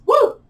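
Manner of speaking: high, loud "woo"; whoop of release. Real, not acted. Teacher releasing an intense stretch, high whoop.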